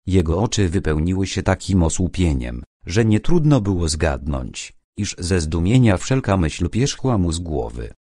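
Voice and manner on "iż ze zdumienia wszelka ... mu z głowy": usual speaking voice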